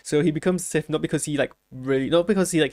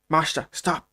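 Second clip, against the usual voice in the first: put-on a quick Irish-accented Liam Neeson-style call; amateur Irish Liam Neeson impression, lightly done; 3 semitones higher, 4 dB louder, slower than usual. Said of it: "That's my best Liam Neeson impression".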